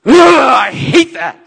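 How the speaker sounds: loud, high, slow groan of annoyance; exasperated. Retold or imitated. Reacting to her ultimatum, exasperated groan.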